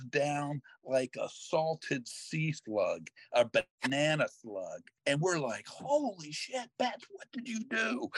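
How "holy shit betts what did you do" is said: high, hushed mock shock; shocked group cry. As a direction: gasp it out in alarm, pitched up. Line drive hits a kid.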